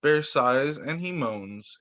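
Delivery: ordinary delivery, nothing marked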